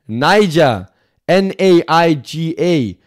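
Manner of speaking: "Niger" said as nigh-ja, then spelled slowly letter by letter; spelling it out. Shown as a demonstration.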